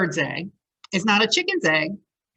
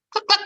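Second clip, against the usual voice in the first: high, louder clucking; chicken cluck; 13 semitones higher, 5 dB louder than usual. Acted. A hen clucks near the children, high cluck.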